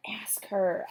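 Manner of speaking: urgent whisper. A narrator's reading. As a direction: whisper it under your breath. Whispered urging to Livy.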